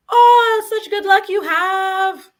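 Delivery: high, loud gushing neighbor voice; overjoyed busybody crowing about luck. Retold or imitated.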